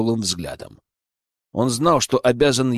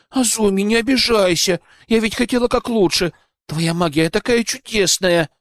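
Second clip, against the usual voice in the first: higher, eager apologetic pleading; 8 semitones higher than usual. Acted. Olga pleads, chasing Azumi.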